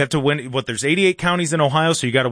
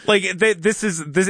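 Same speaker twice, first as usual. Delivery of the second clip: ordinary talk, nothing marked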